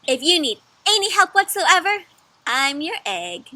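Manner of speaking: bright, loud storybook egg voice; cheerful egg character. Acted. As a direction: play the proud little egg.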